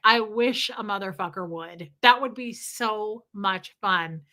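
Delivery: ordinary delivery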